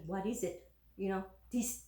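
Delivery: ordinary talk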